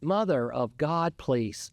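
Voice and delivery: normal talking voice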